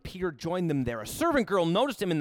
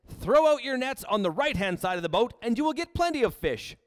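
called instruction; 8 semitones higher, 5 dB louder than usual. Acted. Jesus voiced giving instruction.

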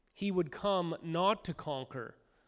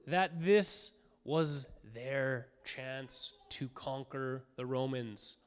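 ordinary delivery

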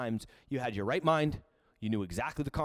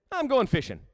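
upbeat announcement; 9 semitones higher, 6 dB louder than usual. Acted. Peter voiced announcing fishing.

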